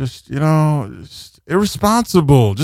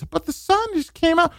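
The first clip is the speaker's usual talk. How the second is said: mock whiny excuse; 6 dB quieter than usual. Imitates a summer-dressed passerby.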